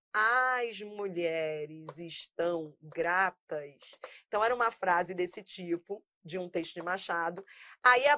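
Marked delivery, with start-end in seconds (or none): 0.1-4.0 "As mulheres estão gratas" read slowly, word by word; slow example read. Shown as a demonstration. Teacher reading the exam sentence to students, slow and deliberate. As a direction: lay out the sample sentence slowly. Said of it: "Se eu digo assim".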